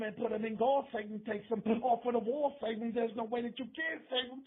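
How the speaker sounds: ordinary talk, nothing marked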